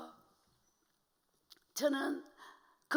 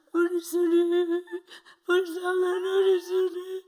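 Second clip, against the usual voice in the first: slow, tearful lament for her daughter; mother weeping; 4 semitones higher, 4 dB louder, slower than usual. Retold or imitated. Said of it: "엄마 혼자서 내를 보고 자꾸 우는 거예요". (우는 엄마 목소리로 애통하게)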